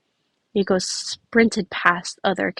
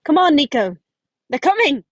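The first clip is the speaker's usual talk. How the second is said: excited call; 7 semitones higher, 7 dB louder than usual. Acted. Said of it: "I can't do a country accent".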